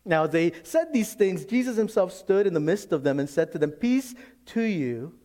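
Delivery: high, forceful Bible reading; preached scripture reading. Real, not acted. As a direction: proclaim the verse, stretching the final words.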